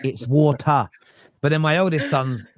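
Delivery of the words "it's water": "water" with the t fully sounded; correcting daughter. Retold or imitated. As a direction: pronounce the t in "water" crisply, correcting Daddy. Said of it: "I get corrected by my daughter".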